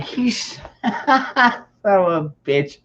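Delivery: high, slow, laughing through the curse; laughing disbelief. Retold or imitated. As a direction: crack up while cursing him out. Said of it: "I was like".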